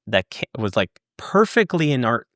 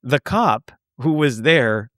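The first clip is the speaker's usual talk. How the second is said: usual speaking voice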